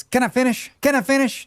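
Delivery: a whiny candidate begging to finish; Perot impression. Streamer quotes Perot at the debate, high whiny plea. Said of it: "My strongest memory of Perot is".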